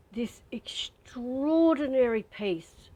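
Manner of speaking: awed whisper. Real, not acted. Teller whispering in awe about the peace she felt. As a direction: let the words float out in awe. Describing sudden spiritual peace.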